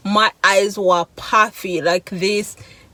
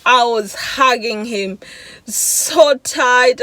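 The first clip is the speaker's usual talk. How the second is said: ordinary delivery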